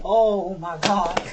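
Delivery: long, drawn-out exasperation; retold dismay. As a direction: stretch every word in mock despair. Mother's retold dismay at son's answer.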